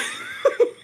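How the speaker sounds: short airy burst of laughter; wheezy giggle after a joke; breathless laugh. Real, not acted. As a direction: crack up with little voice. Laughs after retold jab.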